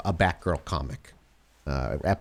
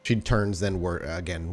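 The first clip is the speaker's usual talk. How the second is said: ordinary delivery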